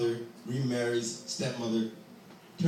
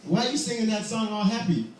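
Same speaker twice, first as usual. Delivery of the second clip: loud, higher shout across; calling out; 8 semitones higher, 6 dB louder than usual. Acted. Vagabond hailing the singing boy, loud call. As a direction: call out to stop him.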